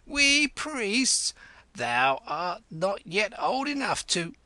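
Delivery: put-on a widow teases the boy priest; teasing old lady. A narrator's reading. Old lady mocks the boy calling himself priest.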